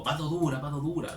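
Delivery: urgent, pleading imitation of the crowd; desperate plea. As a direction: imitate people clamouring desperately for an iron fist. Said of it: "Esa desesperación".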